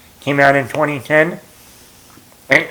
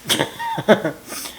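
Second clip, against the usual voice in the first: sudden squeaky laugh, trailing off; high burst of laughter; 6 dB quieter than usual. Real, not acted. Laughs at own story.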